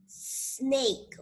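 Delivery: "Snake" joined up after a long s; long initial S. Shown as a demonstration. Teacher putting the word together, long initial S. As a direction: draw out the s, then land "Snake". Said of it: "Primero hacemos el sonido".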